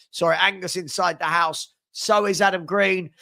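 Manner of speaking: ordinary delivery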